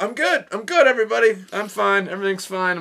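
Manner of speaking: high, loud hurried all-is-fine; mock brave announcement; embarrassed reassurance. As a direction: cover up a painful fall. Fell hard, reassuring whole club.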